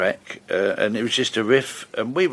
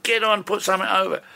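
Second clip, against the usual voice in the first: higher-pitched mock shout to a roadie; retold shout; 9 semitones higher than usual. Musician calling to the roadie in the rain, retold shout. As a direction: call out urgently, without real volume. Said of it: "I'm shouting to the roadie".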